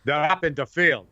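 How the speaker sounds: mother's slight Polish accent, imitated. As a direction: call it out with a bit of a Polish accent. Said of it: "My mother would say in a bit of a Polish accent".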